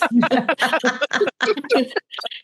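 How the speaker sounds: a long, rolling laugh at herself. Real, not acted. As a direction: laugh off the confession. Laughs at own confession.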